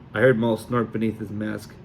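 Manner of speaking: ordinary delivery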